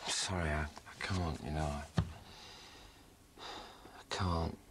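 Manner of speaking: choked whisper. Acted. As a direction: barely get the words out. Husband breaking down at the end.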